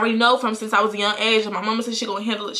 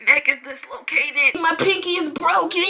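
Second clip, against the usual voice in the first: higher, hammed-up whining; mock pitiful; 8 semitones higher than usual. Girl giving her statement in the ambulance, mock pitiful. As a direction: play up injuries pathetically. Acts out exaggerated injury complaint.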